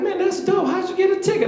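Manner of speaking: very high, excited imitation; impressed coworkers. Coworkers marvel and ask about a ticket, excited. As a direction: squeal it as a mock-impressed friend.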